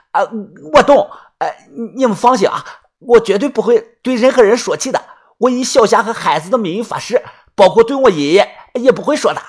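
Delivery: high-pitched, earnest underling; eager sworn follower. Acted. Yuanbao swearing secrecy to the leader, high and eager. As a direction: pitch it up and promise keenly.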